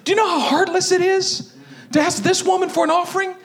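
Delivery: high, distressed plea to God; acted high-pitched objection; pleading protest. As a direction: protest it high, climbing to a cry. Acts Elijah protesting to God.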